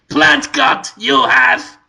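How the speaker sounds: high clipped broken-English shopkeeper that collapses; failed Marin impression. Shopkeeper pitching a plant, impression falling apart. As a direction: try to keep it short and sharp, and lose it. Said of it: "That falls apart".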